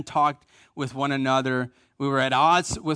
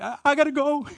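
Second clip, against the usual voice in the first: a higher, hurried mother cutting the call short; flustered mom; 9 semitones higher than usual. Retold or imitated. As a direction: play the mom getting choked up and hanging up. Mom voiced, flustered on phone.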